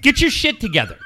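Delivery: exasperated, high-pitched reproach; heated retold scolding; retold laying-into. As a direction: re-enact chewing out your son. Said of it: "So I lay into him".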